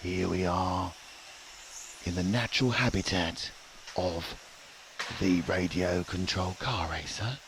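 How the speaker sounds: hushed wildlife documentary voice; nature documentary narrator. Acted. Wildlife documentary parody commentary.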